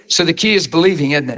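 ordinary talk

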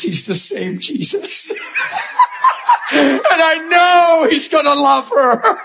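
laughing while talking. Real, not acted. Preacher moved by favourite scene.

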